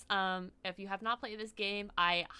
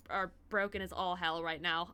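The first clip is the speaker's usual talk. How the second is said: usual speaking voice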